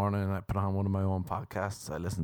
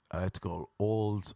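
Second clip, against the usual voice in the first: ordinary delivery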